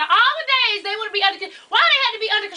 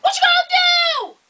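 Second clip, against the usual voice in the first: high, loud laughing yell; mock shout; 13 semitones higher, 5 dB louder than usual. Daughter mocking her mom's braiding offer with a loud laughing yell.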